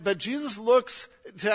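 ordinary talk, nothing marked

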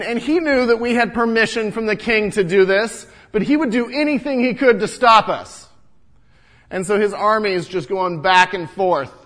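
usual speaking voice

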